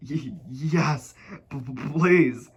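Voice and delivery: slow, shaky stutter; timid stuttering yes please; quivering stammer. Acted. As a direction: stammer it out, trembling. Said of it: "quivered and shook and said".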